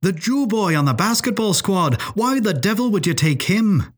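high-pitched incredulous questioning; astonished outburst. A narrator's reading. Professor questions her choice of companion, incredulous outburst. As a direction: burst out in disbelief, pitch riding high. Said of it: "burst O'Malley".

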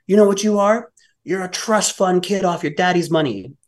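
high-pitched parting insult; sneering drug addict. Retold or imitated. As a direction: mimic an agitated man's jeer.

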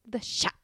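French-style CHAT ending on the vowel; French pronunciation. Retold or imitated. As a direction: say CHAT as "sha". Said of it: "they're always like".

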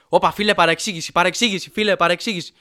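put-on loud, rushed drunk peacemaker; urgent mock intervention. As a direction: jump in to defuse a fight, loudly. Breaking up mock fight with stove.

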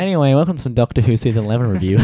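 booming, fast show intro; loud mock announcer. Reviewer jokingly announces a Doctor Who review, loud and fast. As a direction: announce it like a TV host.

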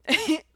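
quick real laugh; short laugh. Real, not acted. Telling listeners about emailing before surgery, amused laugh. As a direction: burst of a laugh at herself. Laughing at her own story.

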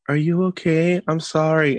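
put-on high child voice, apologetic; imitated concerned son. Son checking on hurt sister, high imitated concern.

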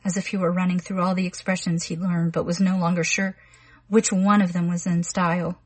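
ordinary delivery, nothing marked

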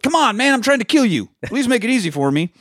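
hammed-up high villain plea; mock villain. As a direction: whine it high like a cartoon killer begging. Voicing killer mid fight.

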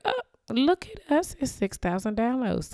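high, delighted exclamation; excited. Retold or imitated. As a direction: gush with surprise at the news. Excited reaction to seeing downloads.

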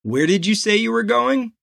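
half-serious. A narrator's reading. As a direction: ask it half joking, voice lifted. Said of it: "said in a half-serious voice".

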